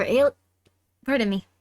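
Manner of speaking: hiccup breaking into the words; talking through the hiccups. Real, not acted. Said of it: "I have the hiccups".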